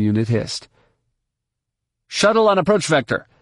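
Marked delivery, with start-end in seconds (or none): 2.1-3.3 higher-pitched official on the radio; comm controller. A narrator's reading. Traffic control hailing an approaching shuttle, official comm voice. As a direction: challenge an incoming craft as a traffic controller. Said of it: "a male voice said".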